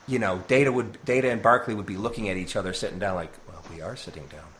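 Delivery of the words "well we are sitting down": low, hushed comic aside; deadpan aside. Acted. Data and Barclay to each other, deadpan aside. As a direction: drop it softly, under the breath. Imagined sheepish aside in comedy.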